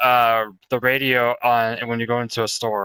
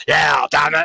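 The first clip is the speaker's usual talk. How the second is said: wrestling promo; 4 semitones higher, 4 dB louder than usual. Retold or imitated. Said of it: "A wrestling impression".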